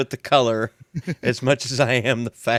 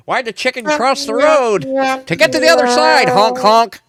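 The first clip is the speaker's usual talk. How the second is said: high, loud goofy clown voice; silly clown; 13 semitones higher, 8 dB louder than usual. Retold or imitated. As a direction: ham it up like a honking party clown. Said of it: "like that clown with the stupid horn".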